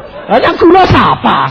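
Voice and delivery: loud, slow, high laugh through the words; laughing retort. Retold or imitated. Pastor laughing back at the frightened man, slow laughing retort.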